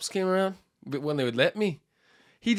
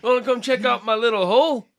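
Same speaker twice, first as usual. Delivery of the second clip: high-pitched, loud Australian sales patter; mock Australian accent; 8 semitones higher, 6 dB louder than usual. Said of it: "That's your Australian accent?".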